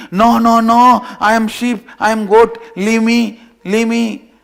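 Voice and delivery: high, loud panicked protest; mock screaming. Cub to the lion, screaming that he is a sheep. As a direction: scream no at him like a terrified lamb. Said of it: "starts screaming".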